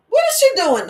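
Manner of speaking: a hint of a puzzled mother; puzzled mom. Mom overhearing the mirror talk.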